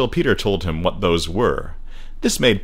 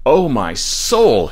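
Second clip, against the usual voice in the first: slow, higher drawn-out moan; 5 semitones higher, 4 dB louder, much slower than usual. A narrator's reading. Father lamenting with a mild oath, slow moan. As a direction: moan it slowly, stretching each word. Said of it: "he would moan".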